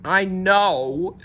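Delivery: high wailing cry. Acted. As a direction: wail it out in self-pity. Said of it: "wailed Moose".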